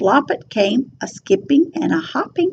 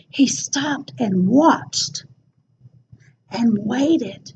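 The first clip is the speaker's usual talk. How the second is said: suspenseful whisper; 2 semitones lower, 5 dB quieter, slower than usual. A narrator's reading. Suspense while waiting.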